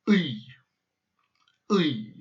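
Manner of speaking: "Uy" swallowed back toward the throat; Russian letter sound. Shown as a demonstration. Teacher demonstrating "Uy" to learners. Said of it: "it sounds like this".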